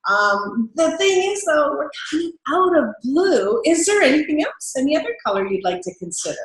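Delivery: cheerful rep turning down the blue request; high enthusiasm. Shown as a demonstration. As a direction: delight even while saying no. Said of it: "Then you want it to be like".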